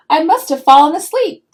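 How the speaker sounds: ordinary delivery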